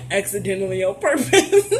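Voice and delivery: repeating it through real laughter; giggling Accidentally on purpose; laughing. Real, not acted. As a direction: crack up while saying Accidentally on purpose.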